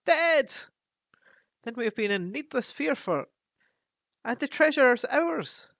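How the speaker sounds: loud, high, gleeful cry; joyful dwarves. Acted. Dwarves rejoice that the treasure is theirs. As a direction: cheer the news out loud.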